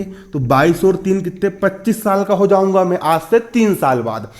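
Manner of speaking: normal talking voice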